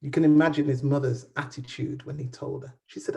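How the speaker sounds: ordinary delivery, nothing marked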